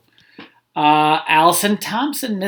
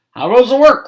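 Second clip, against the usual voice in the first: higher and louder farmer call; bossy farmer shouting from bed; lazy farmer calling; 5 semitones higher, 6 dB louder than usual. A narrator's reading. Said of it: "called the farmer".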